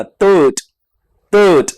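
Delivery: तर्ट with a soft dental t at the start, not थर्ड; soft t in third. Shown as a demonstration. Teacher corrects students: तर्ट, not थर्ड. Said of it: "थर्ड नहीं बोलना".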